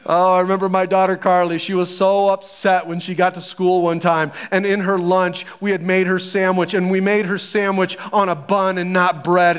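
ordinary delivery

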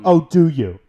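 playfully exaggerated sarcasm; mock surprise. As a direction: pounce on it with teasing disbelief. Teasing sarcasm after a confession.

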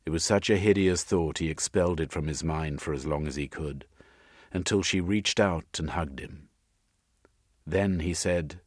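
ordinary delivery

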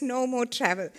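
ordinary delivery

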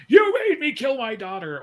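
put-on high, loud drunken rant; town drunk. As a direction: yell it up high like a ranting drunk. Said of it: "like, incoherently ranting".

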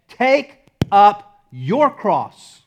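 loud, slow command, each word set apart; firm command. Retold or imitated. Jesus ordering Peter through the crowd, commanding. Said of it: "That was a command".

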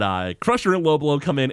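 ordinary talk, nothing marked

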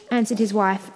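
ordinary delivery

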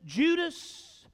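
usual speaking voice

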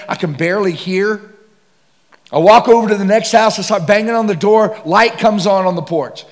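loud, high sermon delivery; preaching at full force. Acted. Preacher telling the congregation about banging on doors, loud sermon pitch. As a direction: drive it home to the crowd.

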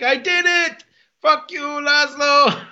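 high, drawn-out mock victory cry; gleeful hammed-up taunt, pitched high; happy triumph. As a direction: crow it to the world, imitating him. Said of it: "he's so happy".

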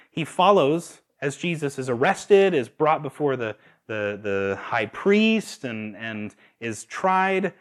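ordinary talk

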